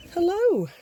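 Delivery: high, loud call to the birds. Real, not acted. Owner calling out to her chickens, loud and high. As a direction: sing out a bright hello across the yard.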